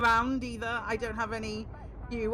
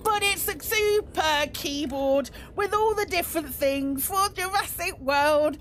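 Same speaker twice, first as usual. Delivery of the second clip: slow, higher announcer reveal; grand announcement; 7 semitones higher, slower than usual. Pretend. Streamer unveils the new keyboard to chat, slow and grand. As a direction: present it like a big product launch.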